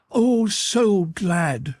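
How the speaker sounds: high, slow, drawn-out gladness; glad cry. A narrator's reading. Girl answering Bradley, slow and high with joy. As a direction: let the joy stretch out. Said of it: "she cried".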